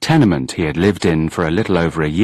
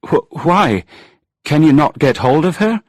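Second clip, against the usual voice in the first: worried questions; 8 semitones higher than usual. A narrator's reading. Angus worried, stammering questions.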